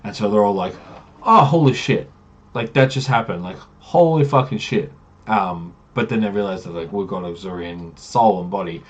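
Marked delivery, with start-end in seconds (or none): 1.2-4.9 loud, higher-pitched stunned outburst; acted awe, punching the swear words; stunned shock. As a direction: react as if you barely escaped. Party reacts to narrow escape.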